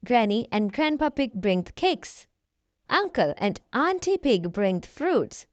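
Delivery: ordinary delivery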